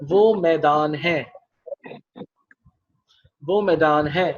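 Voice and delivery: deliberate teacher cue; slow prompt. Shown as a demonstration. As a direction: say it slowly so pupils catch it. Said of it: "teacher prompt repeated".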